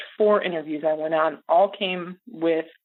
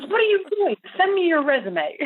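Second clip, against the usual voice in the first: high mock yell with a laugh; retold yell; 12 semitones higher than usual. Mentor scolding him for underselling himself, high mock yell. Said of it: "And she yelled at me".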